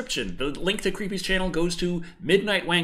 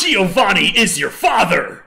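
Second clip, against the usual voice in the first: loud, slow, hammed-up villain reveal; dramatic reveal; 3 semitones higher, 9 dB louder, slower than usual. Retelling the musical's reveal to a co-host, in a loud hammed-up stage voice. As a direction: boom it out like a stage villain's big twist.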